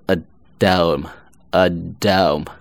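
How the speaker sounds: slow, deliberate "a dome", h dropped; linked weak form. Shown as a demonstration. Teacher demonstrating at becoming a d link, slow "a dome". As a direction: say at home as uh-DOME, "a dome", stretched out. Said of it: "at会读为".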